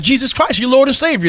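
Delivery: ordinary delivery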